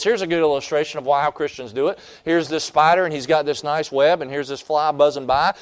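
normal talking voice

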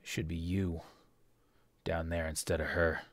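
soft, contemptuous aside to his men; governor's bitter rebuke. Acted. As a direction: blame your own guards quietly. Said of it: "and he says".

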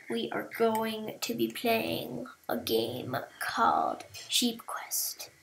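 soft whispered intro; whispering. Real, not acted. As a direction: whisper it to the viewers.

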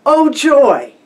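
loud, high call; calling the dog. Retold or imitated. Woman calls her dog by name.

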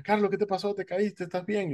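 lightly voiced worried lady asking if he fell. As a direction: fret over him like a worried old lady. Worried lady calls down stairs.

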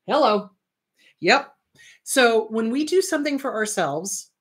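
ordinary delivery

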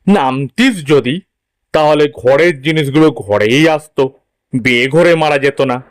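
father's voice, pressing his son; a dad laying out what could have been won; insistent father. Acted. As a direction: voice a father urging his boy to compete. Father figure in story.

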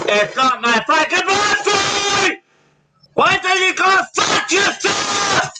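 high, drawn-out angry yelling; exasperated fury. Real, not acted. Rejecting yet another happy birthday greeting, furious shout. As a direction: blow up and shout it out.

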